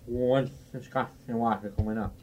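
lightly voiced halting bartender; speech impediment. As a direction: answer with the same mild speech defect. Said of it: "that's the way I talk".

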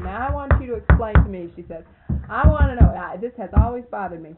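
put-on loud, insistent old lady questioning; Miss Mary demanding. An old lady loudly demanding Virgil explain scripture. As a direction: press him for an answer, loud.

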